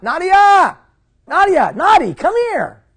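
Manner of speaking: loud high call down the hallway; yelling out. Retold or imitated. Dad shouting down the hallway to his daughter, loud high call. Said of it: "And I yelled out".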